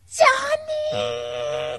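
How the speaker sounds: a drawn-out mock shout of the name; mom shouting name. Groom's mom grabbing the mic and yelling his nickname. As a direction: call the nickname across the room. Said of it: "何言ったかっていうと".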